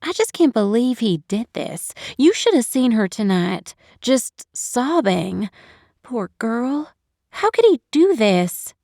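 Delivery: distraught outburst. Acted. Woman tells boyfriend of sobbing friend, distressed and indignant.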